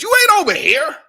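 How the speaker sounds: loud high shout. Real, not acted. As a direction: yell it at him. Heated rant shout.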